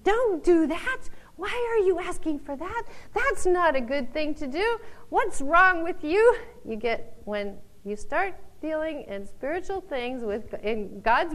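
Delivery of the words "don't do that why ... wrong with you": higher, louder scolding voices; disapproving crowd. Retold or imitated. Preacher voices critics scolding someone who cries out. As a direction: scold like nosy onlookers. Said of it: "other people's opinions".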